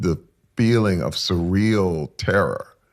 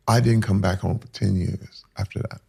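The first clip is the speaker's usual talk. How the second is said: ordinary talk, nothing marked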